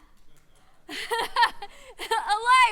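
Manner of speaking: a big burst of real laughter; loud laugh. Real, not acted. Laughing at her own aliveness joke, loud and hearty.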